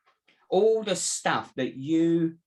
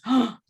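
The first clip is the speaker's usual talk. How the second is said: sharp, louder gasp; mock shocked gasp; 8 semitones higher, 6 dB louder than usual. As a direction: gasp as if suddenly upset. Acts out mind's upset reaction.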